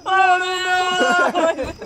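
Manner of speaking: long, high, loud wail of WATERMELON; playful drawn-out shout. Pretend. Friends joking over food, playful drawn-out shout. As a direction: belt it out high and stretched.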